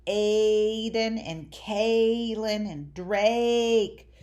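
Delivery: AIDEN, KAYLIN, DRAKE with each A stretched out slowly; slow names, leaning long on every A; long, strong A. Shown as a demonstration. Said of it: "A is long and strong in those names".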